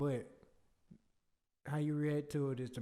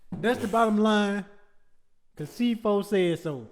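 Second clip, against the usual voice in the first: louder hyped wrestling catchphrase; 6 semitones higher, 8 dB louder than usual. Acted. Cohost joining the wrestling bit with a loud catchphrase. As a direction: shout the bottom line like a promo.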